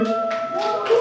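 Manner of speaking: hype woo. Real, not acted. Host hyping the crowd.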